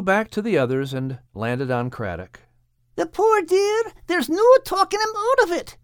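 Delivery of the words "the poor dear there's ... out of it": very high-pitched little character voice; sad, pitying. Acted. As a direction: lament softheartedly in a piping high voice. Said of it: "said Bethu sadly".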